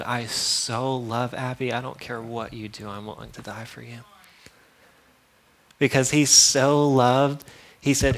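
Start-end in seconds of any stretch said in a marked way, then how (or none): 0.0-4.0 soft, fading tender voice as God. Retold or imitated. Preacher voicing God to Abby, soft and tender. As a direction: voice God's love softly. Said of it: "because he said".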